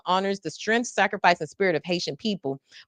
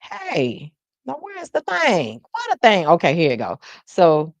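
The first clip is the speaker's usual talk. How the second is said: ordinary delivery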